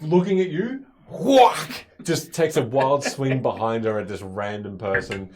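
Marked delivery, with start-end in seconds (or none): 1.1-1.9 loud, high "whack" sound effect; explosive vocal impact noise; imitated blow. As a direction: burst it out like a sudden hit. Game master voices a swung blow.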